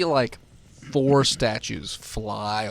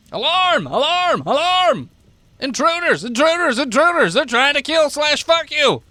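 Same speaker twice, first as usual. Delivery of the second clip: high, very loud repeated screams; screaming alarm; 14 semitones higher, 11 dB louder than usual. Acted. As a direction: shriek the alarm over and over.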